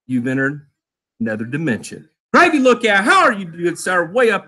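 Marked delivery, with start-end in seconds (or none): 0.1-2.1 much lower, quieter eerie voice; low mock sci-fi announcer; spooky narrator. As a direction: intone it like a TV mystery host. Spooky sci-fi intro line.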